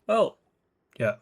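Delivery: ordinary talk, nothing marked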